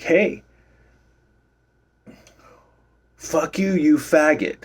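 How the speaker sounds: imitating a drunk's high-pitched taunt, yelled up high; mock angry yell. Retelling the drunk patron's insult, high mock yell. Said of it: "he snapped and he's like".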